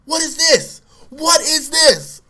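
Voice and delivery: high, loud, furious cop imitation; mad cop yelling. Cop who found the gun, imitated angry yelling. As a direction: demand it like an outraged cop, dragging the repeat. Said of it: "the cop was so mad".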